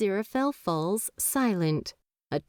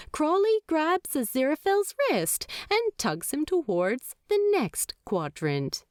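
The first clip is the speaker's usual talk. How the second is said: ordinary delivery, nothing marked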